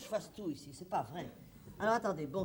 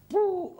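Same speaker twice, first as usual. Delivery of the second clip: hot puff of breath; 10 semitones higher, 7 dB louder than usual. Acted. Overheated, blowing out air.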